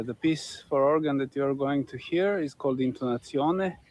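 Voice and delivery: ordinary talk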